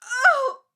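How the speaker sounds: high, loud gasping cry of fright; startled cry. Acted. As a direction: cry out as you're shoved. Head pushed toward hot water.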